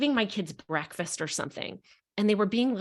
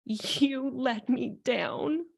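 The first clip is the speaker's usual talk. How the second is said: put-on tearful child; 3 semitones higher, much slower than usual. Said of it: "Her little finger was shaking".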